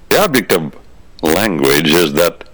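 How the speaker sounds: ordinary talk, nothing marked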